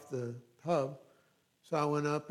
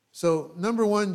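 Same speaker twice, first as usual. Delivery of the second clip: preaching emphasis; 5 semitones higher, 9 dB louder than usual. Real, not acted. Preacher announcing point loudly.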